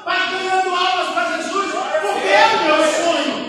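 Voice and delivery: building to a loud, ringing sermon climax; impassioned preaching. Real, not acted. Preacher confesses his love of preaching to the church, impassioned and building.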